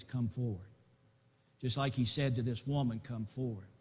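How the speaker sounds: gentle call. Acted.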